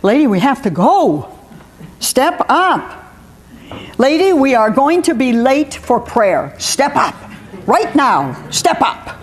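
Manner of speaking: insistent orders rising in pitch; mounting impatience, ending in a rough voiceless command; exasperated command. Acted. Maureen telling Lady they'll be late for prayer, increasingly insistent. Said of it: "right down to the final exclamation point".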